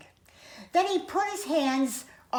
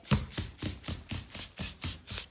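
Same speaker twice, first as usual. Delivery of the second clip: rapid string of voiceless tsks; voiceless mocking monkey chatter; monkey "tss" noises; 4 dB quieter than usual. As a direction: hiss short tss sounds like cheeky monkeys. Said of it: "stamped both their feet and said".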